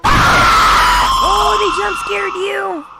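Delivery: loud high gasp; startled shout. Real, not acted. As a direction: yelp as if jump-scared. Jump scare reaction.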